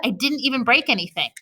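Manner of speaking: ordinary delivery, nothing marked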